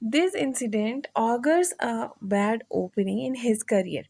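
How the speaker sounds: slow, deliberate reading; slow example sentence. Shown as a demonstration. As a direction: read it slowly so they notice augurs. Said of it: "आप sentence पे गौर करें". (धीमे, ठहरकर पढ़ना)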